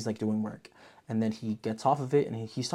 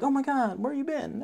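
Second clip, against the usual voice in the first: mock surprised family. Family voiced greeting her.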